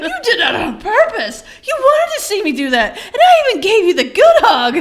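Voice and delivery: son's voice high, loud and laughing; high laughing accusation. Retold or imitated. Son Logan accuses mom of tricking him, high and laughing. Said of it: "he's like".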